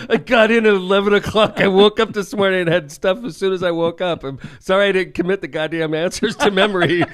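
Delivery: high, loud exasperated complaint; mock indignant rant; salty retort. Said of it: "I'm like".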